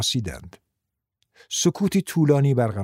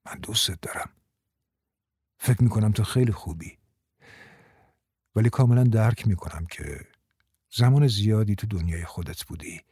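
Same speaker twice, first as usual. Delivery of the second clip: soft, low and reassuring; calm them with a lowered voice; quiet, gentle confession; 5 semitones lower, 3 dB quieter than usual. A narrator's reading. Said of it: "با صدای آرامی گفت".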